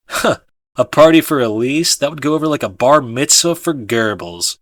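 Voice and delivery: loud, scoffing character voice; scoffing friend. A narrator's reading. Raymond dismissing a party for Elise, loud scoff. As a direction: scoff at the idea loudly. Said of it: "Raymond says".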